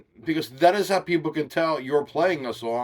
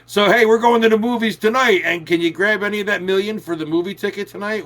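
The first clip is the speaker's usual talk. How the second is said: higher, lightly acted eager request; friend asking for money; 6 semitones higher, 6 dB louder than usual. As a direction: pitch it up, casually hitting up a friend.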